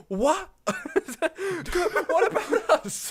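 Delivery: high, drawn-out wail of being left out; mock indignant Korean. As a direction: protest in a high, giggly voice. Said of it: "the Korean was just like".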